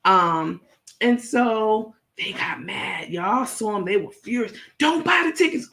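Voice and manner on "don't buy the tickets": high, urgent "don't buy"; frantic warning. Retold or imitated. The hosts warning fans away, high frantic plea. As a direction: plead it in a high panicky voice. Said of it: "Oh, they were frantic".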